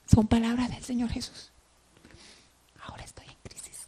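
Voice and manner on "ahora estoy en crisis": whispered. Acted. Preacher paraphrasing Jesus' anguish.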